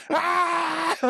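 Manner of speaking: high, hammed-up crazed yell; mock rage scream. Host imitating Knox's insane scream while charging the boat. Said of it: "with so much rage and insanity".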